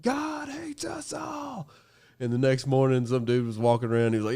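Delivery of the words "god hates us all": drawn-out thrash vocal shriek, held high; metal scream. Retold or imitated. Singer covering Slayer for the race crowd, drawn-out scream. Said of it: "I did just scream".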